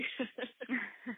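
a brief amused chuckle; quick real laugh between sentences; short laugh. Real, not acted. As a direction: laugh lightly at yourself. Host laughs at Rotary modesty.

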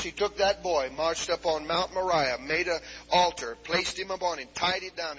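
ordinary talk, nothing marked

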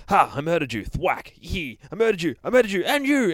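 loud, high gleeful battle yells; screaming bear. Acted. Bear slaughtering monsters, loud gleeful screaming. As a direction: scream with manic glee as you fight. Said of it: "he just starts screaming like".

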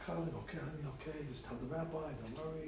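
gently calm him down, quietly; a wife hushing her husband, softly soothing. Retold or imitated. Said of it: "his wife is like".

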